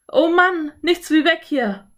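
high, loud cry of alarm; alarmed exclamation. A narrator's reading. Boy to his sister on realizing the danger, alarmed.

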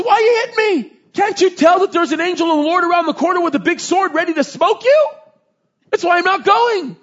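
high, loud donkey voice explaining itself; the donkey warns Balaam about the angel, high and loud; indignant donkey. Retold or imitated. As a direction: whine like a donkey that has seen the angel. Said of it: "He says".

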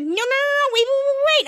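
high, acted frantic protest; panicked pleading. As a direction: imitate someone begging not to be taken. Toddler villain pleading while dragged away.

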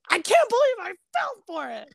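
high-pitched, exasperated character outburst; amateur impression of a cartoon character's dismay; Batty impression. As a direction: pitch it up in mock disbelief. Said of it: "I'm not gonna do it justice".

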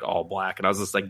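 ordinary talk, nothing marked

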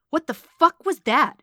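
high, loud, stretched-out outburst; indignant yell, pitch jumping high; outraged demand. A narrator's reading. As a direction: demand an answer in loud disbelief. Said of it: "she demanded".

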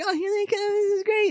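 very high, giddy guest voice; mock delighted crowd. Wedding guests hearing the couple arrive, mock delight. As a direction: squeal like a pleased onlooker, hammed up.

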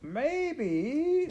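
put-on very high, drawn-out serving girl; Midge. A narrator's reading. Serving girl proposing an idea, very high voice. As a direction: squeal the word out long and high.

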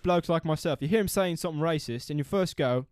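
convincing put-on British accent; British bloke accent. Explaining British people to co-host, British bloke accent. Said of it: "You're doing a pretty good one".

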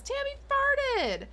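high, slow, drawn-out mock call of Tammy farted; gleeful tattling. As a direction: sing it out like a kid tattling on a classmate. [name] calling out, pointing.

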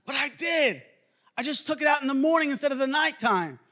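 put-on high-pitched childish excuse; protesting kid. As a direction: argue back like a defensive child. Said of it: "do that to your kids".

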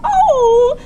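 high squeaky Muppet voice; Elmo voice. Pretend. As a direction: do a bright high puppet voice.

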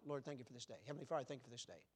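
low, soft, automatic recital; rote prayer. Shown as a demonstration. Preacher mimicking prayer said by habit, low and offhand. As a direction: let it just fall out, unthinking and quiet. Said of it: "That just comes out".

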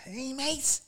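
high mock Scouse echo; Paul Smith. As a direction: imitate a Scouse comic's squeaky hello. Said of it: "that Scouse fella".

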